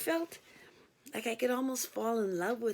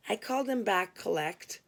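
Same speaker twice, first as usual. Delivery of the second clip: ordinary delivery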